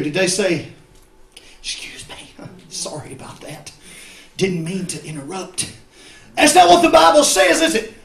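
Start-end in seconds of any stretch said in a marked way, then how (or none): 1.6-5.7 very soft, almost whispered apology; mock meek apology. Blind men imagined apologizing for interrupting, mock meek. 6.4-7.9 high, loud preacher's objection; loud rebuttal. Real, not acted. Preacher corrects the meek version to church, loudly.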